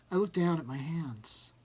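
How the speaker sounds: plain reading voice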